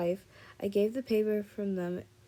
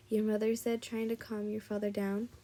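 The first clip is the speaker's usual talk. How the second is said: plain reading voice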